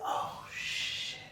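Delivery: breathy, hushed oh shit; hushed realization. Retold or imitated. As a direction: whisper it as it dawns on you. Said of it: "I be like".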